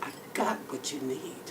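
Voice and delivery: low and hushed suggestive line; soft, sly offer. Retold or imitated. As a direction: murmur it as a tempting promise. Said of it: "he says the same thing".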